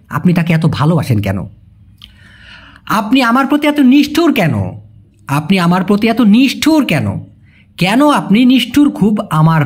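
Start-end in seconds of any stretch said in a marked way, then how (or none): none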